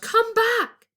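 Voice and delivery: high, loud shout. A narrator's reading. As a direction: call him back urgently. Calling after the prince.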